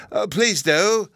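high, drawn-out eager plea; eager cry. A narrator's reading. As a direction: beg for it like a keen little boy. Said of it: "he cried eagerly".

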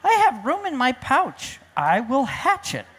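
determined storybook kangaroo mother; eager tree kangaroo. Acted. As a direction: declare it keenly, voice lifted. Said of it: "She said".

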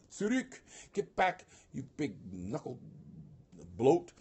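high, loud mock outburst; hammed-up shove-off in a fight; quarrelling giant. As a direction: play an angry giant driving off his brother. Voicing giants' fight over ring.

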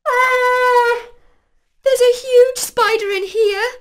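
high, loud scared cry; frightened shout. A narrator's reading. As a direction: shriek at the spider. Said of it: "shouted Pally".

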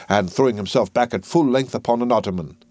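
ordinary delivery

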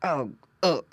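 two short pig grunts; grunting pig. A narrator's reading. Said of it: "grunted Squinty".

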